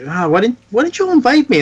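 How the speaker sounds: high-pitched hurt complaint; Iceman. Acted. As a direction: play a left-out hero, voice shooting up. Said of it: "that awkward thing where Iceman's like".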